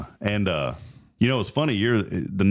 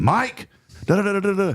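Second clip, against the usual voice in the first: high, raised voice trailing into nonsense syllables; imitated phone greeting; 14 semitones higher, faster than usual. Dad overheard phoning from the kitchen, imitated high calling voice. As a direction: call out the name, then rattle off filler.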